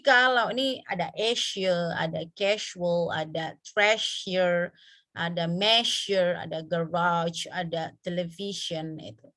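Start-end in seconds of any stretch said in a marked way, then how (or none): 1.9-7.5 casual, treasure, measure, garage drawn out slowly; slow word examples. Shown as a demonstration. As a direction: read each example word slowly for the class. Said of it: "ini contoh-contoh yang sure".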